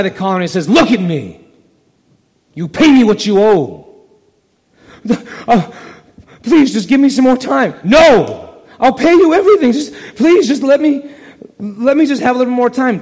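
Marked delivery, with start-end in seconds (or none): 0.7-3.9 loud, threatening shout; angry demand. Acted. Servant grabs debtor by collar. 5.5-13.0 much higher, loud pleading; desperate begging. Acted. Debtor begs for more time.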